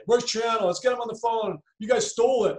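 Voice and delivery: hammed-up grumbling accusation; imitating Charles Barkley. Retelling Charles Barkley's complaint.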